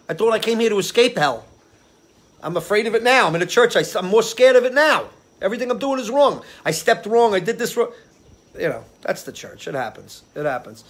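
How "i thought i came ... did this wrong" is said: frightened churchgoer. Retold or imitated. Retelling his fear leaving church.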